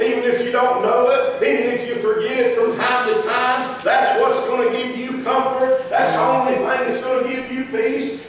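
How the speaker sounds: rising preacher's fervor; preaching. Acted. Preacher telling the congregation about his faith, preaching.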